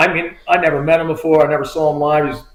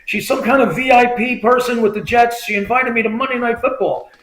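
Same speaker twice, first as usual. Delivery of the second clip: higher, eager phone voice; imitated excited friend; 7 semitones higher than usual. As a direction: play a buddy bursting with news.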